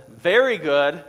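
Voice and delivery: loud, wry approval; a hint of a pleased God; mock approving God. God approving the fish plan, loud and wry. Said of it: "And God says".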